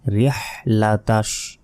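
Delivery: রিহ লাতাশ slowly, strong pharyngeal h after "ri"; throaty big h. Shown as a demonstration. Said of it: "গলার ভেতর থেকে এই বড় হাটি".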